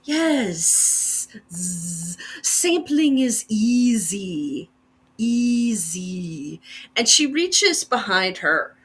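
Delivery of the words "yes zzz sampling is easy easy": slow, breathy whispered insect voice, ending in a buzz; a graceful, menacing bee creature whispering slowly; menacing bee woman. Acted. Bee woman answers customer.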